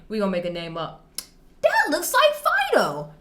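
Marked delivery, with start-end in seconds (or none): 1.6-3.1 put-on high, excited kid pointing out the dog; little cousin voice. Imitating her little cousin spotting a lookalike dog, high kid voice. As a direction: squeak it out like an eager young boy.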